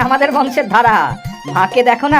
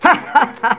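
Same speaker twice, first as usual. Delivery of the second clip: short burst of higher-pitched chuckles; amused laugh; 6 semitones higher than usual. Acted. Husband answers wife's jibe about marrying, amused laugh. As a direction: laugh off the teasing.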